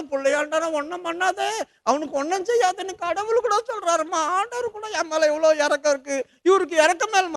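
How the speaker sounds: put-on a scared boy blurting it all out to his mother; frightened child. Isaac tells mother, terrified child. (பயந்த சிறுவனின் மிக உயர்ந்த குரல்)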